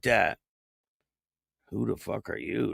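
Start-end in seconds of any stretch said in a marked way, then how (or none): 1.7-2.7 muttered chat quote. Retold or imitated. Host reading a rude chatter's line, hushed and unimpressed. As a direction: read it under your breath, unimpressed. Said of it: "Don't come in here talking like that".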